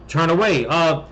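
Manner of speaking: ordinary talk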